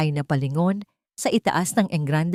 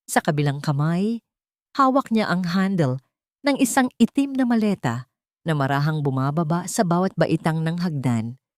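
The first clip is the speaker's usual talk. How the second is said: plain reading voice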